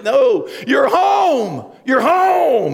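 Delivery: high, loud, slow No, you're home; joyful insistence. Retold or imitated. God hugging a reluctant believer in heaven, joyful and loud. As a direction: burst out with delight. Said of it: "He's gonna be like".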